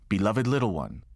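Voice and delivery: normal talking voice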